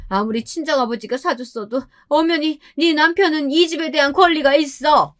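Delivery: high, theatrical mother-in-law lament; hammed-up, high-pitched complaint; put-on wailing. A narrator's reading. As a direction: make a show of crying to guilt them. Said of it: "갑자기 울면서 쇼를 하시는 거예요".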